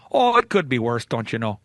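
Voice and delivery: a hint of Minnesota, low and easy-going; Minnesotan accent. Shown as a demonstration. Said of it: "teach you how to speak Minnesotan".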